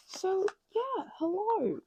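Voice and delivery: high, loud cheery greeting; high, loud vlog hello; bright hello. Real, not acted. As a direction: greet the viewers with a lift. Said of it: "video greeting".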